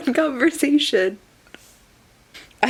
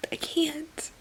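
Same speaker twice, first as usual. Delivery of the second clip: whispered I can't, breathless; real stunned whisper; 6 semitones higher, 10 dB quieter than usual. Real, not acted. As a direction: let it out in a hush, at a loss. Stunned at the book.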